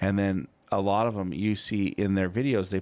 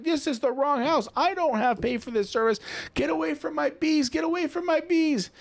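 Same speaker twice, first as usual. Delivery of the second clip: angry homeowner. Retold or imitated. Homeowner yells at the lawn guy to get out, shrill high shout. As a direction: shriek at the trespasser to leave.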